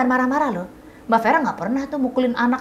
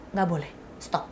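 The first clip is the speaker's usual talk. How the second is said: firm calm stop; 6 semitones lower, 7 dB quieter than usual. Shown as a demonstration. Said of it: "coba latihan bilang".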